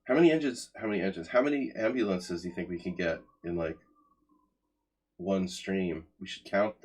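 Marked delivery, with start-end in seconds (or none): 0.0-0.7 high, loud excited burst; excited exclaim. Real, not acted. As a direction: call it out with a jolt of excitement. Streamer exclaims about viewer count.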